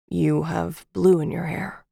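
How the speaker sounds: lightly voiced smooth man; rich smooth male voice. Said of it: "His voice was rich and smooth".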